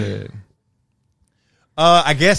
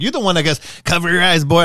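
put-on young kid scolding, raised and louder; 6 semitones higher, 6 dB louder than usual. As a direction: play the kid telling him off. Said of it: "my kid be like".

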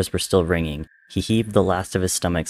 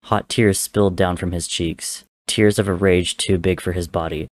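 usual speaking voice

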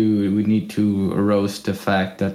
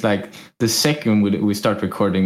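usual speaking voice

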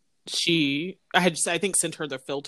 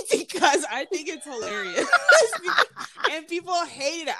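laughing; 10 semitones higher than usual. Real, not acted. Laughing at own joke.

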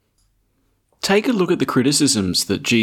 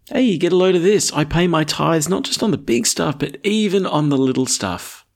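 higher, fast bragging Pharisee; smug boast; 5 semitones higher than usual. Retold or imitated. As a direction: show off your tiny tithes, lightly mocking.

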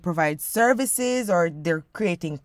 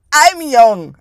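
loud, insistent declaration; emphatic; 3 semitones higher, 11 dB louder than usual. Real, not acted. Insisting on own youth.

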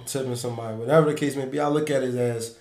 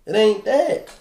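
higher and louder, fed up; exasperated; 8 semitones higher, 5 dB louder than usual. Real, not acted. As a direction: tell them to chill, loudly. Scolding pushy bar guys.

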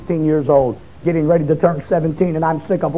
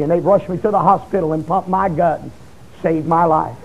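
ordinary talk, nothing marked

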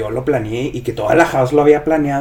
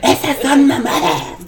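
mock yelling; 10 semitones higher, slower than usual. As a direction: mimic a slow hysterical shriek, half laughing. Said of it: "luego empezó a gritar". (remedo agudo de gritos, alargado)

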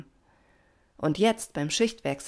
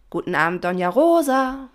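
calling out; 7 semitones higher, 8 dB louder than usual. A narrator's reading. Pina hails Doña Rosa from afar, calling out loudly. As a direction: sing out a greeting across the water. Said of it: "Dann fing sie an zu rufen".

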